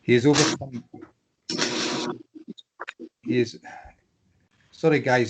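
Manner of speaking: ordinary talk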